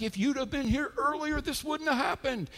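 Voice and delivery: high-pitched mock complaint; crowd complaining. Mourners blaming Jesus for coming late, high complaint. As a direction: whine it upward as the reproachful crowd. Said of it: "everybody's like".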